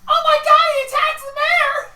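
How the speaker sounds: high, loud panicked yelling; alarmed crowd shout. Acted. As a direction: scream the alarm to the town. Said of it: "they're like".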